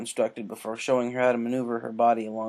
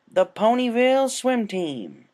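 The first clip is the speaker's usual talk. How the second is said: slow, louder and higher announcement of the team; announcing; 11 semitones higher, 7 dB louder, slower than usual. A narrator's reading. Applejack introduces the swim team to Rainbow, proudly announcing. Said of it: "Applejack announced".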